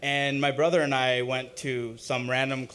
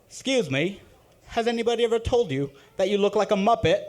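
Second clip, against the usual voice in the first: put-on higher Australian accent, teasing; sultry Australian accent, a little laugh; 8 semitones higher, 3 dB louder than usual. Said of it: "in the hottest Australian accent".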